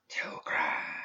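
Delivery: slow, breathless whisper from far off; ghostly whisper. A narrator's reading. Dead student's voice reaching Perdita's mind, ghostly whisper. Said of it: "it said".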